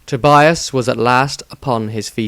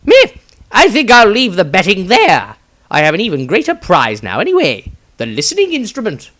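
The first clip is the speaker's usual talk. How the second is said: higher, louder breezy wizard; shrugging wizard; 10 semitones higher, 6 dB louder than usual. Acted. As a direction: shrug it off lightly. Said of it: "Maestro shrugged".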